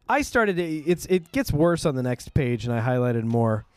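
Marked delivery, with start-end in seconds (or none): none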